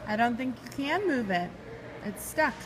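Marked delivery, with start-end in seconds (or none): none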